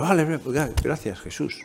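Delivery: soft, grudging "okay, okay, thanks"; resigned mock thanks. Disciples grudgingly thanking Jesus, resigned and soft. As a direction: drop the voice and thank him reluctantly.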